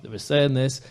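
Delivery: ordinary talk, nothing marked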